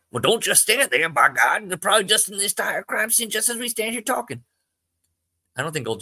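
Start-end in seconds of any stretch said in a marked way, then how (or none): 0.1-4.4 urgent. Acted. Joe urging haste.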